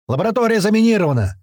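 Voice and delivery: high, quick alarm call; shouting. A narrator's reading. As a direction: shout a hurried warning, pitch well up. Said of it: "крикнул нам появившийся Котлета".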